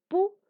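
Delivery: "Púas" with a high, loud PÚ, then a gap; burst on U. Shown as a demonstration. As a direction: explode the ú in "Púas". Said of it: "explosión de voz".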